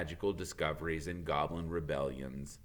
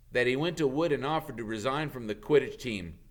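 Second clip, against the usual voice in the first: plain reading voice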